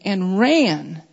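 "ran" set apart and leaned on after a pause; "ran" emphasized on its own; stress on RAN. Shown as a demonstration. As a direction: hit "ran" as the key word. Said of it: "here's what I'm emphasizing".

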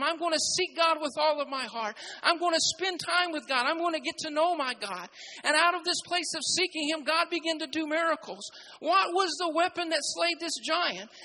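loud, driving sermon climax; fervent preaching. Acted. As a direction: preach it hard to the congregation. Preaching builds to full force.

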